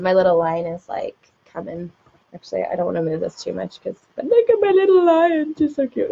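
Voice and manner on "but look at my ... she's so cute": cooing over pet. Real, not acted. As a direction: gush sweetly, pitched way up. Gushing over her little lion.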